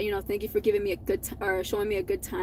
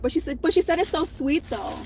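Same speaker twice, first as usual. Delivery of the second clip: ordinary talk, nothing marked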